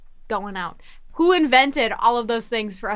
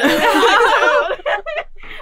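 loud, high laughing fit; hard laughter; 10 semitones higher, 5 dB louder than usual. Real, not acted. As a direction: burst out laughing really hard. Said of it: "[name]'s laughing really hard".

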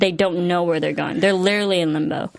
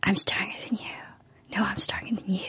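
mock whispering; 10 dB quieter than usual. Host parodying rivals getting into each other's heads, whispering. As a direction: imitate a hushed power-play exchange. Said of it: "they were talking so low".

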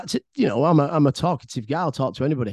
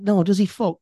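higher-pitched, incredulous retort; indignant denial; 6 semitones higher, 3 dB louder than usual. Retold or imitated. Nephew answering welfare officer about his uncle, indignant denial. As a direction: re-enact a scandalised denial.

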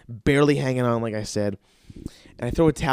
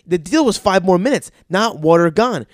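furious, loud and high; angry yelling; 6 semitones higher, 7 dB louder than usual. Retold or imitated. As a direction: yell at the kid in a rage. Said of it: "I go".

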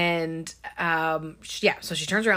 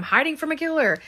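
high, rushed retort; retold frantic comeback, fast and high; mock defensive yell; 9 semitones higher, 4 dB louder, faster than usual. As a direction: protest quickly in an acted panic. Acted defensive retort, retold scene.

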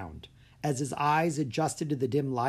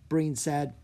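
usual speaking voice

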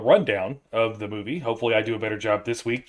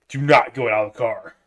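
firm, louder warning; scary dad command; stern dad voice; 6 dB louder than usual. Retold or imitated. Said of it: "get scary, Dad".

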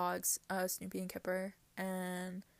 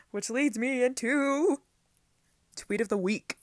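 segment announcer; 5 semitones higher, 7 dB louder than usual. Acted. Announces her weekly segment.

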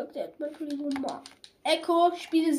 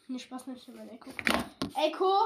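normal talking voice

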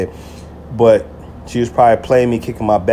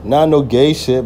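usual speaking voice

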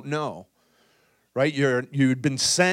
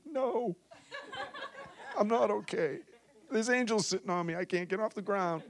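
laughing reply; 8 semitones higher, 6 dB quieter than usual. Retold or imitated. Retold overwhelmed answer with laughter.

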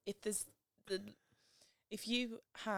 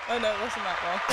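ordinary talk

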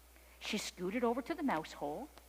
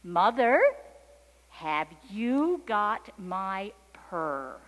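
slow, louder call to mother; 9 dB louder, much slower than usual. A narrator's reading. Kitten back at the barn, calling out slowly. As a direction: call the question out, drawing out each word. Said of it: "she called out her question".

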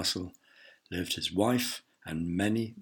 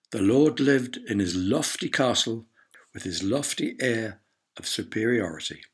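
ordinary delivery, nothing marked

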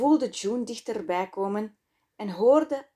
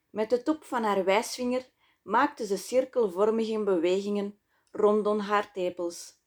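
ordinary delivery

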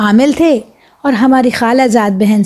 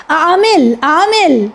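mock yelp; 5 semitones higher, much slower than usual. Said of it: "چیخ کر بولی".

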